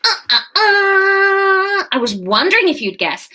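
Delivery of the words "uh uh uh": teasing uh uh uh. Real, not acted. Teasing reveal before the twist.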